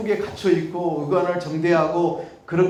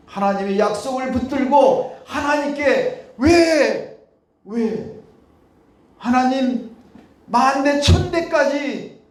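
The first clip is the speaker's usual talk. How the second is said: raised, emphatic pleading preacher voice; preaching at full force; 6 semitones higher, 4 dB louder than usual. Acted. Preacher modelling a bold prayer, raised emphatic voice. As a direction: press God with the promises, voice lifted.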